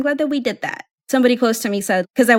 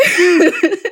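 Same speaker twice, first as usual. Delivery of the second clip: laughing; 9 semitones higher, 7 dB louder than usual. Real, not acted. Laughing at celebrity story.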